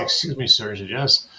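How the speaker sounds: ordinary talk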